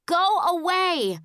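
high yelled dismissal. A narrator's reading. Crying child sending brother away from closet, high yell. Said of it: "I yelled at him".

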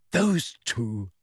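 usual speaking voice

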